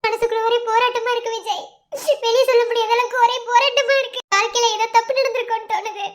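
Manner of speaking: tearful, sobbing confession; crying. Acted. Wife confiding her inner struggle to her husband, crying. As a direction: pour it out through sobs. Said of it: "இப்ப எதுக்கு நீ அழுதுட்டு இருக்க".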